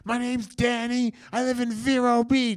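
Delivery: Danny's high voice. Retold or imitated. Danny introduces himself on the phone, high and strained. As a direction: squeak out a name and hometown. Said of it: "And he's like".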